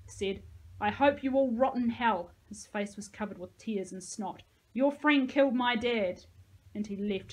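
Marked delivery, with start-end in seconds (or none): 0.8-2.3 loud, embittered outburst; bitter curse. A narrator's reading. Tearful boy curses those in the pit, bitter curse. 4.7-6.2 loud, raw accusing cry; anguished accusation. A narrator's reading. Boy accuses them over his dad, anguished accusation.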